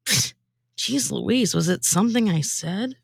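ordinary talk

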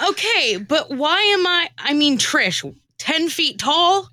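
ordinary talk

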